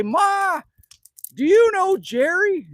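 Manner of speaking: put-on high, loud kid voice calling to mom; a kid tugging at mom's sleeve with a question; boy asking his mom. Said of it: "And say".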